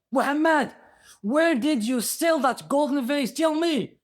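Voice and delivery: high, loud, accusing demand; acted outraged interrogation; accusing vizier. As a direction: demand answers in a high, forceful voice. Vizier accuses man of stealing.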